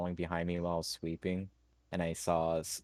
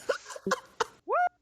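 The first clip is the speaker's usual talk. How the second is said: high laugh; 3 dB louder than usual. Real, not acted. Player laughs at introduction joke.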